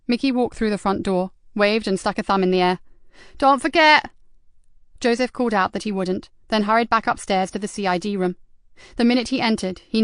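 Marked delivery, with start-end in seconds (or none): 3.4-4.1 calling out. Acted. Mickey calls out leaving.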